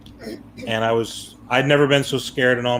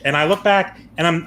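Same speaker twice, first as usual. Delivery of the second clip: usual speaking voice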